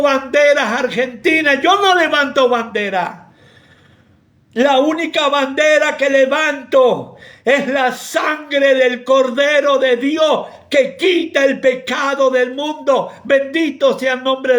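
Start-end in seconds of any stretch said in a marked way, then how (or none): none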